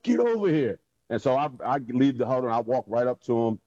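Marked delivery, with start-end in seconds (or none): 0.0-0.8 high-pitched mock holler from the sideline; imitated coach's call. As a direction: summon him sharply, as the coach. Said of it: "B C coming up the sideline".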